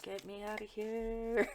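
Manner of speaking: a lightly voiced bored husband begging to go; mock husband voice. Voicing the husband's inner wish.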